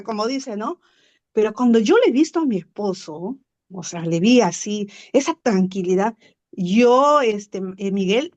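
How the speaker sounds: ordinary delivery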